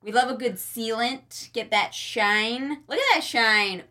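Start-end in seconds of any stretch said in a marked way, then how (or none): none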